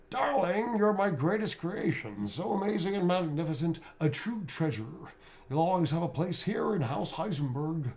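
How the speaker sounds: high, slow, theatrical aristocrat; grand, doting villain purring praise; Heisenberg voice. Acted. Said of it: "special instructions".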